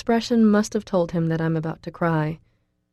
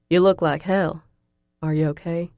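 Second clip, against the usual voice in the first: ordinary delivery, nothing marked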